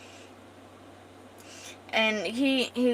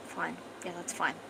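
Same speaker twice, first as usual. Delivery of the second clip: quiet, grudging acted assent; unhappy agreement; 2 semitones lower, 9 dB quieter than usual. She reluctantly agrees to share a room, grudging and quiet. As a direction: agree softly, clearly not pleased. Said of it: "She's not happy about it".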